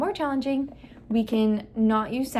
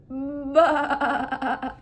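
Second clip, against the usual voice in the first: long, wavering higher "baa"; sheep bleat; 4 semitones higher than usual. Shown as a demonstration. Clinician presents the sheep sound to the child, bleating. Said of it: "present the sound to them".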